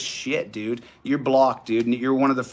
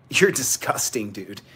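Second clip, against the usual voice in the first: ordinary talk, nothing marked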